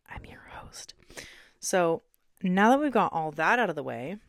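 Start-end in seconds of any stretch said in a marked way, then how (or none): 0.1-0.9 soft unvoiced whisper, slowing. Host introducing herself to listeners in a whisper. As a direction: drop to a breathy whisper for the last words.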